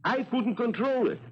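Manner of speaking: high, pleading denial; desperate protest. Acted. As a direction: plead in panic. Sailor pleads his innocence.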